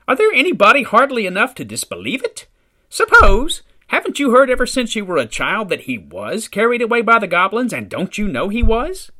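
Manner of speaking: put-on high, loud outraged old lady; indignant scolding. Old mother rebuking son for doubting goblins, high and loud.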